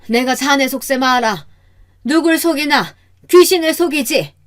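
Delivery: high-pitched indignant challenge; accuse, pitch climbing with each phrase; scornful accusation. Acted. Mother-in-law seeing through son-in-law, scornful accusation.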